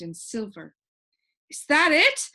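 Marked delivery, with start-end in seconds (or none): 1.5-2.3 much higher, louder eager shout. A narrator's reading. Milo shouts as he runs to the shining streets. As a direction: call out running toward it. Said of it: "shouted Milo".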